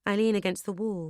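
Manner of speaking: usual speaking voice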